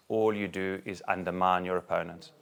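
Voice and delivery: usual speaking voice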